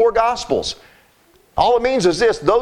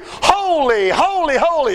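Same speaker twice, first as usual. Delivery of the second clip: high ringing holy, each one higher; crying holy; 7 semitones higher, slower than usual. Retold or imitated. Preacher voicing beasts crying holy before the throne. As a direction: call it out like the beasts.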